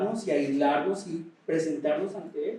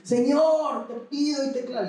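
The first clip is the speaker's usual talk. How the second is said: high, raised cry to the Lord; 8 semitones higher than usual. Shown as a demonstration. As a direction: lift the voice and cry out. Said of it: "hay que elevar la voz y decir". (clamando en voz alta y aguda)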